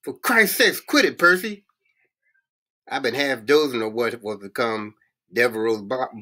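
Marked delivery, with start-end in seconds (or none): 0.0-1.6 high-pitched urgent holler. A narrator's reading. Brutal yelling at Percy to quit it, hollering. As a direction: holler at him to stop. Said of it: "Brutal hollering".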